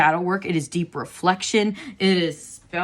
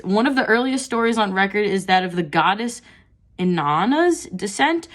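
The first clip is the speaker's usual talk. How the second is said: reading aloud; 3 semitones higher, 4 dB louder than usual. Acted. Reads guidebook text.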